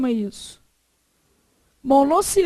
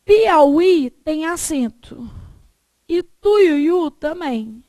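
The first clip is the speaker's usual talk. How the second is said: Piauí and Tuiuiu said very slowly, each vowel distinct; slow emphasis; 3 dB louder, much slower than usual. Shown as a demonstration. Teacher presents odd exceptions to students, very slow. Teacher stresses example words.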